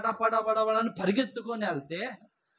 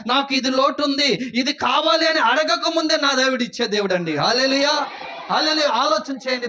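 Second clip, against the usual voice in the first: loud, high, shouting praise; fiery preaching; 7 semitones higher, 9 dB louder than usual. Acted. Pastor rousing congregation, loud shouted praise. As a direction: lift the church up, loud.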